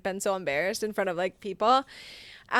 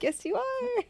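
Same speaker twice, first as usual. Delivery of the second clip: sing-song mommy reply; 13 semitones higher, 3 dB quieter, slower than usual. Retold or imitated. Mom affirming daughter.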